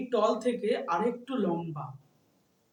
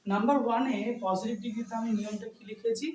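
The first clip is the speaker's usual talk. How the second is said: ordinary talk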